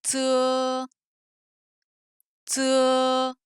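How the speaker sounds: "tse" long and level on one pitch; drawn-out tse, twice with space between; syllable drill. Shown as a demonstration. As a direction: hold the e of tse evenly for repeating. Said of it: "on va ajouter des voyelles".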